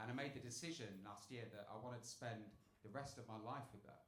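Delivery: ordinary talk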